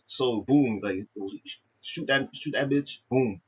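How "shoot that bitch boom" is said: ordinary delivery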